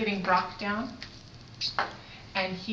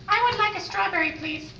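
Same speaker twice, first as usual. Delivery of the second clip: toy figure voice; 8 semitones higher than usual. Pretend.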